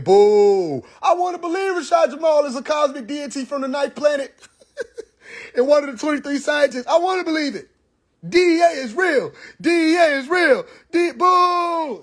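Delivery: high, laughing put-on voice of jeering fans; mocking whine of people begging to believe, laughing through it; mock booing followers. Followers booing their teacher, in a high laughing mock whine.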